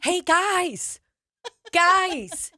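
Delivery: high, sugary mock greeting; sweet, too-friendly hello; fake friendly. [name] waving at the women in their car, sugary high. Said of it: "I was like".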